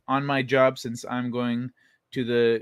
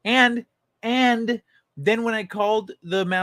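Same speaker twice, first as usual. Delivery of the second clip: annoyed; 7 semitones higher, slower than usual. Real, not acted. Annoyed rant about closed manufacturer.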